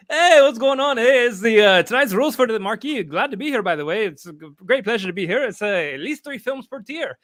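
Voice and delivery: loud, high, hammed-up Canadian voice with eh; mock Canadian accent. Co-host as North Paul, hammy Canadian accent. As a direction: play a goofy Canadian guest reading the rules. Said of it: "here to read the rules tonight for you guys is North Paul".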